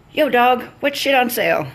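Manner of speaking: high, loud mock street voice; a put-on bro voice; mock thug customer. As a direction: sneer it like a swaggering customer. Mocking customer's rude greeting.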